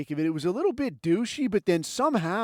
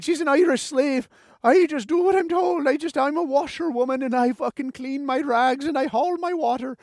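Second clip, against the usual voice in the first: high-pitched mock Irish washerwoman; Irish accent; 9 semitones higher, 4 dB louder than usual. Comic playing a slave mom for laughs, mock Irish accent. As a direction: put on a raised, hammy Irish lilt. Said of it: "Irish accent".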